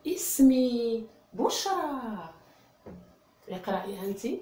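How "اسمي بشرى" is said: slow, stretched reading of اسمي بشرى, a little louder; slow model reading. Shown as a demonstration. Teacher reads the board sentence to the pupils, slow and clear. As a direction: read it out slowly for the class to copy. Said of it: "نقرأ الجملة على السبورة".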